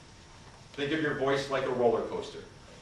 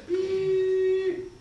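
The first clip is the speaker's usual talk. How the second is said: one long high steady beep; long unbroken machine tone; flatline beep; 7 dB louder than usual. Shown as a demonstration. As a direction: hold one note like a flatlined monitor. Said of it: "What happens if you hear this?".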